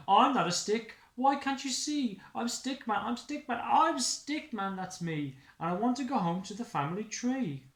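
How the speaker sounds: high, loud pleading protest; desperate Stickman. Acted. Stickman pleads with the dog, high and upset. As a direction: cry out to be recognised.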